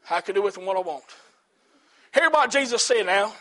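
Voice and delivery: ordinary talk, nothing marked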